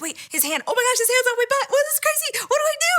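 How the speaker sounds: very high, squealed mock panic; retold giddy freak-out; screaming inner panic. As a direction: rush it out in a rising squeal. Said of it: "screaming 'cause I don't know what to do".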